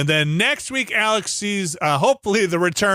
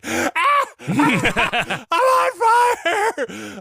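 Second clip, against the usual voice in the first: screaming; much slower than usual. Retold or imitated. Wife in the kitchen screaming after the pepper. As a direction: shriek as if your mouth's on fire. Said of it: "I heard her from the kitchen just scream".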